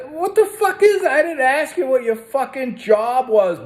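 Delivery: high-pitched, indignant interruption; exasperated outburst. Retold or imitated. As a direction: cut the clerk off in exasperation. Acts out snapping at clerk Brian.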